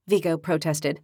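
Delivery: ordinary delivery, nothing marked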